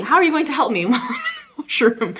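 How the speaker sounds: laughing in disbelief; amused, incredulous laughter. Real, not acted. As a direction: laugh through the absurd question. Mocking the employee's offer of help.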